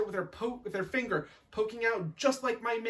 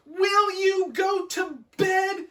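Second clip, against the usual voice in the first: high, slow exasperated yell; shrill, loud bedtime rant; ranting shout; 13 semitones higher, 7 dB louder, slower than usual. Acted. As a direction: shriek each word at a stubborn child. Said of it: "She'd shout, she'd rant and rave".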